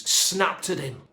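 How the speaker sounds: usual speaking voice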